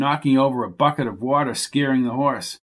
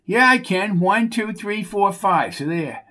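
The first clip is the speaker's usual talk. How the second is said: higher, proud sing-song count; childish Bucky counting; 7 semitones higher than usual. Acted. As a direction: show off like a kid.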